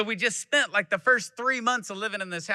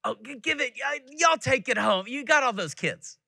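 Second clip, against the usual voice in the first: higher-pitched imitation of a kindly Texas lady; lightly voiced Texan lady urging leftovers; church lady offering leftovers; 8 semitones higher than usual. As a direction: mimic a sweet neighbor pressing food on you, pitched up. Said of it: "And they'll say".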